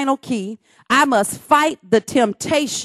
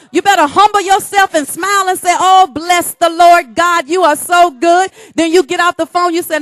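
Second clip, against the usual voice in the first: louder, high exhortation; preaching at full force; 4 semitones higher, 5 dB louder than usual. Real, not acted. Preacher urging the church to praise, fervent. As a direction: proclaim it with fervor.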